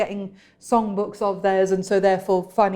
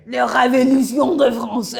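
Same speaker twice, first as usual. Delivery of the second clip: put-on French accent; 5 semitones higher, 5 dB louder than usual. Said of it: "How did I do with that?".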